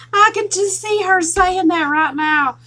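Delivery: high, loud and drawn out after a big laugh; amused, laughing. Real, not acted. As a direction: talk through the tail end of a laugh. Cracking up over the name.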